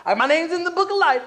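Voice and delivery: high-pitched whiny objection; mock defensive protest. Believer excusing himself to the preacher, mock defensive. Resister's voice, then answered plainly.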